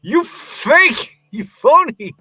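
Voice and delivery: high, loud, hammed-up accusation; mock outraged shout. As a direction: yell it in comic indignation. Mock outrage at co-host's slip.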